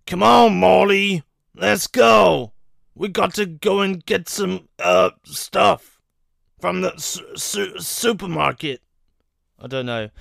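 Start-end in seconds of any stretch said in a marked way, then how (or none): 0.0-8.8 lightly voiced Rick, louder at the start; a hint of a gruff cartoon scientist calling out; Rick impression. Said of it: "I'd probably just do Rick in general".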